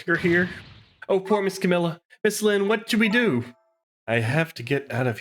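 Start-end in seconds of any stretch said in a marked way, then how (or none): 0.1-3.5 small worried voice. Acted. Little character worried about Camilla.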